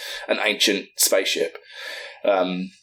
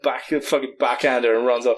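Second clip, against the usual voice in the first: normal talking voice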